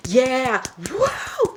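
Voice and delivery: high, whooping imitation of a heckler; antagonizing hype-man whoops; mock cheering. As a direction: cheer obnoxiously, pitch leaping up. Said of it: "making unnecessary noises".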